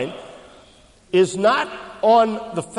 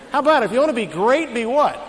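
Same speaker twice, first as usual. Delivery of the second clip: higher, louder and faster call to the congregation; urging the crowd; 6 semitones higher, 5 dB louder than usual. Shown as a demonstration. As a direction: fire the question at the hall to get the answer. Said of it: "That side didn't know".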